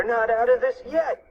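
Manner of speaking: high, moderate cartoon yell; yelled warning. A narrator's reading. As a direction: call the warning out high, without full force. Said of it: "yelled Fluffy".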